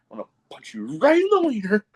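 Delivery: high, quick mock threat; imitated wrestler promo. Podcaster quoting a wrestler's joint video promo, high mock threat. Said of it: "He's like".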